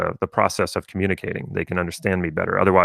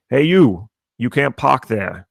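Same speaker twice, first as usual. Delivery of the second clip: loud, slow Boston security guard calling out; Boston accent; 4 semitones higher, 4 dB louder, slower than usual. Retold or imitated. Boston guard telling visitors they can't park there, in a broad Boston accent. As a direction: bark it like a Boston lot guard with a thick Boston accent. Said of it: "these people really speak this way".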